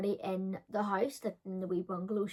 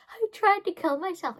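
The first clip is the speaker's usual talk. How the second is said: higher, louder melodramatic claim; dramatic teen; 10 semitones higher, 5 dB louder than usual. Retold or imitated. Teen tells the roommates, higher and melodramatic. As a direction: announce it for effect. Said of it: "I was just being dramatic".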